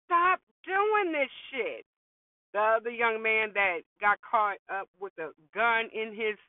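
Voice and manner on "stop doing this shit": higher, slow exasperated scolding; mock scolding mom. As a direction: scold him like a fed-up mother, stretching it out. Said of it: "I'd be like".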